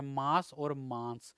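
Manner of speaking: slow, careful contrast of मास with no nasal and मांस with a nasal; contrasting pair, slowly. Shown as a demonstration. Teacher to students, slowly sounding मास then nasal मांस. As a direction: set मास against मांस slowly, letting the nasal in मांस ring. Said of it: "अनुस्वार का क्या अंतर है".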